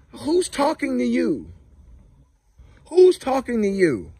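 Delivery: high, loud talking-to the ducks; sassy high scold at the birds. Real, not acted. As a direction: give the ducks a telling-off. Scolding ducks, calling to them.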